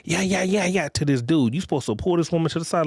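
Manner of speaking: ordinary talk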